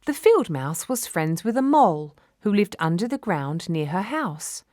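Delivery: plain reading voice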